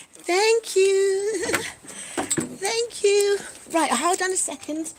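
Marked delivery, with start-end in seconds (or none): 0.1-3.4 high, loud, drawn-out gratitude; delighted high-pitched thank-you; effusive thanks. Real, not acted. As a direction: gush your thanks with a little laugh. Thanking viewer for kind comment.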